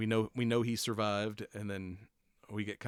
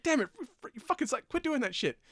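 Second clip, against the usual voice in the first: high, louder mock outburst; exasperated yell; 4 dB louder than usual. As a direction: sputter at him, fed up. Daredevil yelling at Frank, retold.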